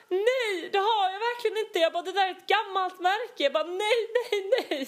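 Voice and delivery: imitating her own panicky protest to the stern colleague; flustered high denial. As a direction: deny it in a panic, voice jumping up. Panicked denial of denting car.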